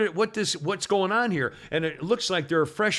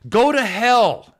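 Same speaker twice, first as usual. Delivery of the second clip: slow, louder, higher go to hell; louder, higher and slow, savouring each word; defiant retort; 6 semitones higher, 8 dB louder, much slower than usual. Retold or imitated. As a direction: read the union's reply like a slap. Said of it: "replied to the A C L U this way".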